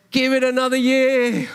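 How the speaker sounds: high, loud, joyful plea; gardener's plea said with elated joy; delighted plea. Acted. Gardener pleads, preacher delighted.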